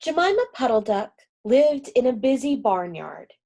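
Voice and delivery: plain reading voice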